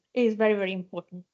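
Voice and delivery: ordinary talk, nothing marked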